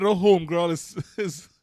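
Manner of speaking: hammed-up sassy commentary on the cop; sassy read of own note. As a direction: read the note with loud mock attitude. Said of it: "I wrote".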